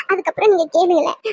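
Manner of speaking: usual speaking voice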